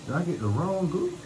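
high, wavering dismay; slow high-pitched realization of a blunder; dismayed disbelief. Real, not acted. Vlogger finding he bought wrong glue, dismayed.

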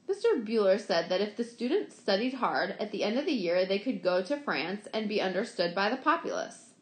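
ordinary delivery

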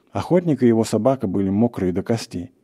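plain reading voice

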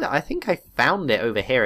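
normal talking voice

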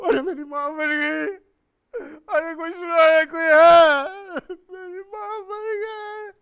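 high, loud, drawn-out crying wail; full-out acted bereaved sobbing; wailing grief. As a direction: howl for help through tears. Acting grief at mother's death.

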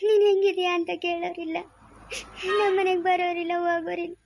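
acted sobbing lament with long tearful pauses; crying. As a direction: wail out your grief between sobs. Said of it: "ಅಳ್ಬಾರ್ದು ನೀನು".

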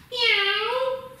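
very high squeaky mock meow; cat meow. Yoga teacher to children in cat pose, high playful meow. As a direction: meow like a little kitten, way up high. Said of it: "breathe out into your cat".

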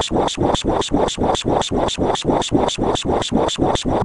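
breathy unvoiced swa swa noise, rhythmic; whispered swishing noise. Narrator mimics a sound effect, unvoiced rhythmic swa. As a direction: hiss out a steady swa swa swa.

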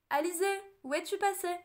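put-on loud, high call searching for a lost child. A narrator's reading. Servant calling for the lost princess in the forest, loud high shout. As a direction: shout her name across the clearing. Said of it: "cria-t-elle".

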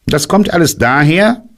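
hoch, lauter und bestimmt, „kommt“ betont; streng. Acted. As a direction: streng wie ein Lehrer zurechtweisend. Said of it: "sagte Kaninchen streng". (Kaninchen weist Puh streng zurecht)

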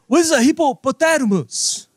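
high-pitched mock questioning voice; put-on caller. Acted shouter in put-on accent.

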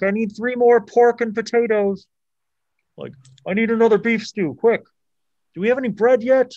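high, loud harried cook calling orders; shouted kitchen orders. Acted. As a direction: bark the orders across a busy kitchen. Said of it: "You hear some orders being called off".

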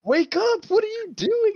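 high, loud alarmed yell; mock yelling to wake someone up; retold yell. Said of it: "I wake up to Sam yelling at me".

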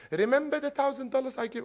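high-pitched, quick mock nagging; nagging reminder. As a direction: imitate someone who keeps bringing up a favor. Acted nagging reminder from a lender.

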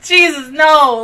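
ordinary talk, nothing marked

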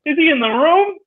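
lightly voiced coworker; the coworker's voice. Coworker's voice asking about the room, lightly done. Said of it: "you're doing a very good impression of him".

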